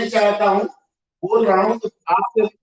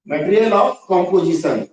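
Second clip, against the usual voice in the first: slow dictation; much slower than usual. Shown as a demonstration. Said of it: "मैंने भी आपको बताया".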